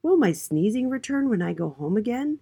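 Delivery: mildly anxious character voice; frowning, worried. A narrator's reading. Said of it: "Frowning, Miss A said".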